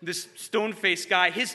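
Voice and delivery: plain reading voice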